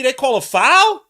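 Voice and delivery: high, loud, slow incredulous cry; can't believe the whistle; outraged disbelief. Real, not acted. Fan protesting a foul call on his team, incredulous cry.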